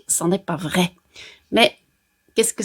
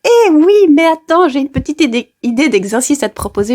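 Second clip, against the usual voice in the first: much higher, louder, overly cheerful; sweet-talking the boss; 6 semitones higher, 5 dB louder than usual. Pretend. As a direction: pitch it high like coaxing a boss. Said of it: "essayer ... avec votre boss". (voix enjouée et aiguë pour amadouer le patron)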